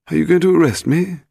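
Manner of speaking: high-pitched anxious question; nervous. A narrator's reading. Boat owner to the policeman, high and nervous. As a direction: ask nervously, pitched well up. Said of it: "he asked nervously".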